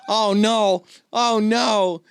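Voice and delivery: mock panic. Retelling his botched third accent, mock panicked dismay. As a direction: play up the sinking realization.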